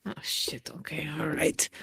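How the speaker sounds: whispered grumble to oneself; muttered aside. Acted. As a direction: whisper it very softly, resigned to obeying. Pedro mutters after being summoned.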